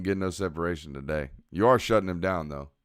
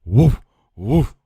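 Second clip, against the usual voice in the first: high, much louder barking woof; loud dog bark; 7 semitones higher, 14 dB louder than usual. Shown as a demonstration. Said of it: "Or am I supposed to go".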